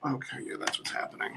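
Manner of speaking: hushed, voiceless self-talk; whispered aside. Real, not acted. Makeup artist to self while working on the face, whispered aside. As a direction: whisper it to yourself.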